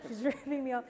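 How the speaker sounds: laughing through the words; laughing at the memory; laughing retelling. Retold or imitated. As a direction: laugh as you say it again. Repeats the scene laughing.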